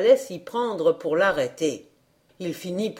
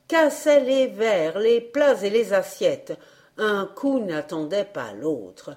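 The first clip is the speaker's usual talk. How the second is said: usual speaking voice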